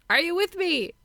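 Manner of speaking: high, loud mock rallying cry; hammed-up high-pitched call and answer; playful rallying call. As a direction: act out a rousing film exchange. Acted film exchange, rallying call.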